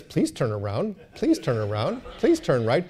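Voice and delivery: soft, patient sat-nav prompts; kindly machine guide, softly repeating itself; gentle GPS voice. Acted. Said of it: "it very gently says".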